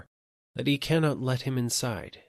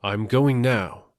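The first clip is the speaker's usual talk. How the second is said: raised voice, not a full yell; mild shout; 3 dB louder than usual. A narrator's reading. Gatekeeper calls out to the dying man, mildly raised voice. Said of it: "he shouts at him".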